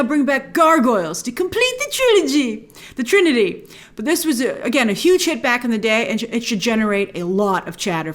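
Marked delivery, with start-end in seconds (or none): 0.0-3.7 higher-pitched mock-grand flourish; geeky excitement over a completed set; excited, hammy. Real, not acted. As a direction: draw it up high with playful drama. Excited wish for gargoyles comeback.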